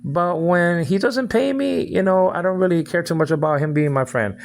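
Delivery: lightly voiced mock friend; mocking imitation. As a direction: mimic her in a sing-song sneer. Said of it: "You can't pick".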